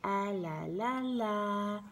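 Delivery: put-on soft, slow singsong baby babble; toddler's lullaby babble. Mother imitating her daughter reading the book, lullaby babble. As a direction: coo the syllables to a gentle lullaby tune. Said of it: "she knows the, the intonation".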